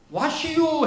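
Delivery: high, drawn-out Chungcheong dialect; lightly voiced Chungcheong barber greeting. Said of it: "인사를 어떻게 했겠어요?".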